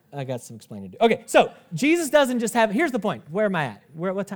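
normal talking voice

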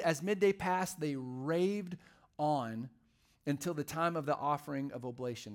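ordinary talk

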